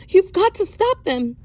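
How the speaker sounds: high, loud demand; urgent plea. Acted. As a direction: insist he act now. Urging the sheriff.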